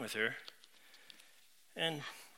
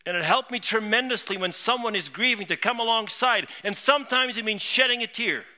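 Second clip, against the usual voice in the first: fervent preaching; 5 semitones higher, 7 dB louder than usual. Acted. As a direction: preach it with swelling conviction. Impassioned preaching to congregation.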